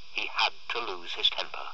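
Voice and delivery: plain reading voice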